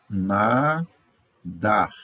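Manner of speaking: slow na-dar, long "na" and a pause; syllable split. Shown as a demonstration. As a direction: break "nadar" into two drawn-out syllables. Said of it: "Separe as sílabas de forma adequada". ("nadar" devagar, separado em sílabas)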